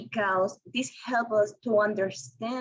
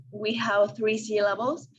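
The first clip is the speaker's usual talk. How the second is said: ordinary talk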